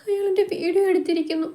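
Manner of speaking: high, tearful and broken, words catching in the throat; a young woman breaking down as she confesses; sobbing. A narrator's reading. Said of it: "Kalyani പൊട്ടിക്കരയാൻ തുടങ്ങി".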